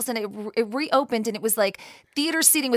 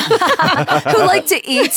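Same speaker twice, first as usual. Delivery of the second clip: laughing; 6 semitones higher, 5 dB louder, much slower than usual. Real, not acted. Joking quip while laughing.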